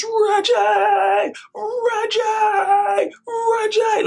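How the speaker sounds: high, drawn-out delighted call; imitated excited shout. Dad spotting Reggie at customs, mimicked high excited cry. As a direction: holler the name like an overjoyed dad. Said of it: "He's so excited to see him".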